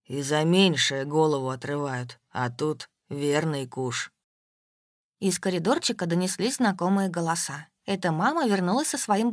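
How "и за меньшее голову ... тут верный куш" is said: low, very slow grim male voice; gloomy Степан. A narrator's reading. Степан grimly adds about the jackpot: low, slow and gloomy. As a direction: drag it out low and ominous.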